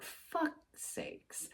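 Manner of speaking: barely audible whispered swear; whispered curse. Retold or imitated. Driver seeing police lights come on, whispered curse. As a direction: whisper it to yourself in dismay.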